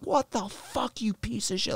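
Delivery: high, held-back mock yell; retold angry scream. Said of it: "we start screaming at each other".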